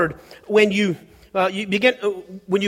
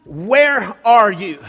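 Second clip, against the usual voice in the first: raised, drawn-out call to someone hidden; 4 dB louder, much slower than usual. Retold or imitated. God's voice searching the garden for the man, calling out. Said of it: "what it was saying was".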